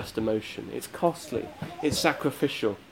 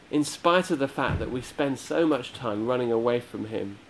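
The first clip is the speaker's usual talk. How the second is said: usual speaking voice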